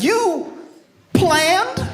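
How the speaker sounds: very high-pitched mock thrill; hammed-up delighted disbelief, way up high; imitating excited girlfriend. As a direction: squeal it like an astonished girlfriend. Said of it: "then she's all excited".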